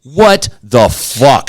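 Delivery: slow, drawn-out mock disbelief; stunned confrontation, acted out; retold incredulous demand. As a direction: stretch it out, word by word, appalled. Retold confrontation at bathroom door.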